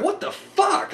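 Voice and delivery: high-pitched, incredulous outburst; stunned disbelief. Retold or imitated. Reviewer recalling the shock ending, stunned high outburst. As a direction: blurt it out in shock at the twist.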